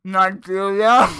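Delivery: slow, loud, through a mouthful; mouth full. Real, not acted. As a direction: say it with banana in your mouth. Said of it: "With a mouthful of banana".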